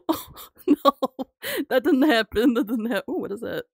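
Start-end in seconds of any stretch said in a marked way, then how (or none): 0.1-2.5 louder, higher mock dismay; mock horror. Real, not acted. Streamer protests the grandma's fall in loud disbelief. Reacting to in-game death.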